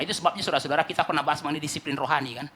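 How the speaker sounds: usual speaking voice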